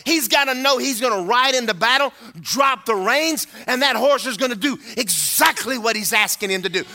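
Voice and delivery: sung preaching cadence over music; preaching at full force. Acted. Preacher riding the music, half-singing the point. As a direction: build the sermon into a chant.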